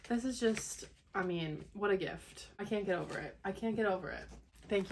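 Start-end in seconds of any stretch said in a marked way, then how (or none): none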